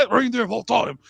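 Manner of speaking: high-pitched mock Australian accent; Australian Hagrid. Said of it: "I was an Australian Hagrid".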